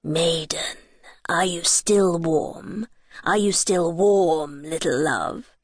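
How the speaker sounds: low, very slow King Frost voice. A narrator's reading. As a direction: stretch each word, low and looming. King Frost's last question.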